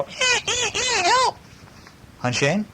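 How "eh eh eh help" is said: very high squeaky piglet cry for help; high-pitched piglet squeal calling for its mother. Acted. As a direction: squeal like a frightened little pig. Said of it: "He'll go".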